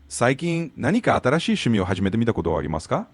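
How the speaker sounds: fast fluent Japanese question; native Japanese question. Shown as a demonstration. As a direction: clear native Japanese prompt. Tutor asks question in Japanese.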